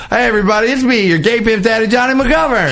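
high, bright announcer hype; hammed-up show intro. Host opens the show in hype mode. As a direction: greet the listeners like a showman.

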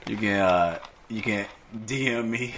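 ordinary talk

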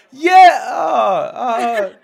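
high loud cheering yell; crowd cheer. Acted. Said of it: "you see the audience go like".

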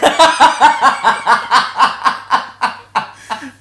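long, loud, high-pitched cackle; loud laugh. Real, not acted. Laughing at the old man's remark, loud long laugh. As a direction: let a big laugh ring out. Big laugh after punchline.

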